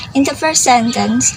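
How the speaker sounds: ordinary delivery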